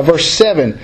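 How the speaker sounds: usual speaking voice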